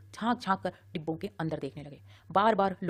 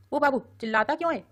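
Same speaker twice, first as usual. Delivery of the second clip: startled retort; 7 semitones higher, 5 dB louder than usual. A narrator's reading. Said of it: "चौंक कर बोला".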